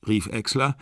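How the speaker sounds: ordinary delivery, nothing marked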